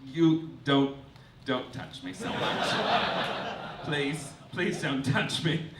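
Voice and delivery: low, soft, pleading; a hint of Tina Fey begging to be let go; quietly pleading not to be touched; Tina Fey recoiling. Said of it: "Tina just being like".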